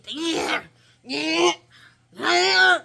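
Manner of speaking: high, loud dryer bursts; mock roaring sound effects; imitated blow dryer. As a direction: mimic repeated yanks with the dryer. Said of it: "the other lady was still".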